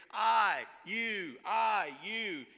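pointing I then you; pointed I and you. Shown as a demonstration. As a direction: hammer each word alternately. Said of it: "You notice the personal nature here".

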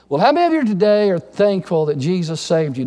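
normal talking voice

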